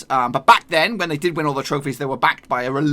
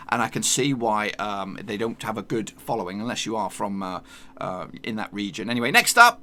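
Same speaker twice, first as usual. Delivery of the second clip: normal talking voice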